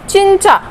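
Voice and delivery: चिंचा said as "chincha" with a clear ch; Korean word demo. Shown as a demonstration. Teacher showing the ch sound, careful. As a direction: model चिंचा with a ch sound both times. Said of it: "मैंने इसको च pronounce किया".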